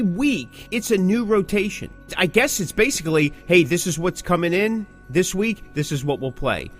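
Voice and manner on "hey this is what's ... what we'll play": ordinary talk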